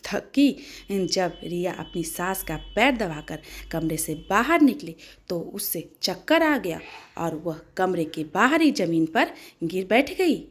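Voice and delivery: ordinary delivery, nothing marked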